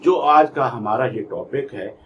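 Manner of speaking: usual speaking voice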